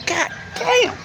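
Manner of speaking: high exasperated mock exclaim; shocked. Narrator reacting to the attendant at his car, high shocked exclaim.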